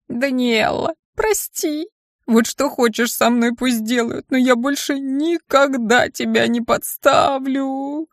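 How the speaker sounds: high, desperate imp on his knees; pleading imp. Acted. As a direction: beg forgiveness in a small creature voice, rising to a cry.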